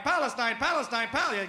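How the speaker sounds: "Palestine" pronounced deliberately, stress on PAL; careful pronunciation. Shown as a demonstration. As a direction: drill "Palestine" like a teacher. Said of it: "Let's pronounce it right".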